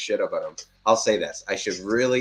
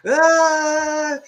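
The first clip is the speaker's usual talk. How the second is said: high squeal; 7 dB louder than usual. Retold or imitated. Squeal after commenters.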